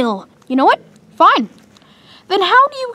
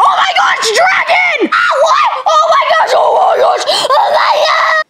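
high shrieking dragon panic; acted panic; 10 semitones higher than usual. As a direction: scream at a dragon, hammy. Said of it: "It's acting".

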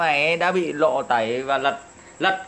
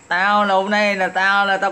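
gloating laugh; 3 semitones higher than usual. Real, not acted. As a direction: laugh at them, then start talking.

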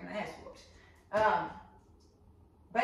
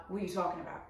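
unmoved, slightly softer deadpan; 3 semitones lower, 7 dB quieter, much faster than usual. Acted. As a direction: brush them off without any reaction.

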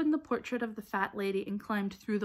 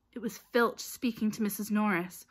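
plain reading voice

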